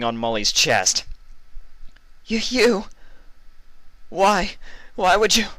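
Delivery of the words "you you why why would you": high, loud stammer; stuttering shock. A narrator's reading. Mully in pain asks Vinyl why, stuttering. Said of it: "Mully stuttered".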